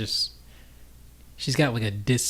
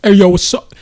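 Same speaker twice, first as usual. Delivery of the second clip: street bro greeting; 9 semitones higher, 17 dB louder than usual. Pretend. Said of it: "a really deep Cockney accent".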